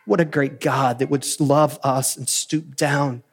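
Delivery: ordinary talk, nothing marked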